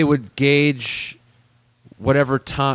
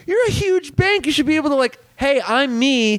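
much higher, incredulous rant; a much higher, fed-up rant at the bank; exasperated rant; 11 semitones higher than usual. Retold or imitated. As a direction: plead at the bank, pitched way up. Ranting at bank, mock plea.

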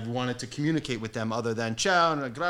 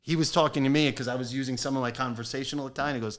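ordinary talk

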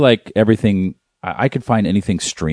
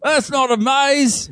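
much higher, slow hammed-up Australian accent; slower than usual. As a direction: drag it out as a mock Australian. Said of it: "I don't know why we're yelling".

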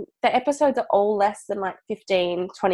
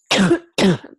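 loud coughing fit; choking cough; 6 dB louder than usual. Real, not acted. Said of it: "choking on my own throat".

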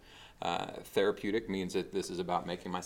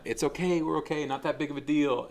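high, louder, carefree brushing-off; mock self-assured churchgoer; mock breezy dismissal; 8 semitones higher, 6 dB louder than usual. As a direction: wave the problem away cheerfully. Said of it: "where we're going".

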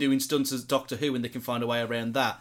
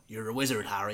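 put-on Hagrid accent; 4 semitones lower, 5 dB quieter than usual. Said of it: "I can't really do the accent".

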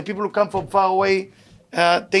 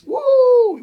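mock victory whoop; 6 dB louder than usual. Mock cheer for a hollow win.

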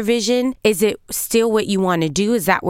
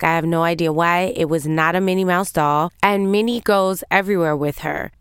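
ordinary talk, nothing marked

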